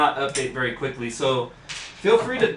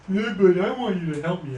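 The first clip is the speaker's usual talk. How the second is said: high loud whiny hero voice; mock Bruce Wayne; 5 semitones higher, 6 dB louder than usual. Mocking Bruce Wayne pleading with his butler, high whiny. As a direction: whine it like a spoiled kid to his butler.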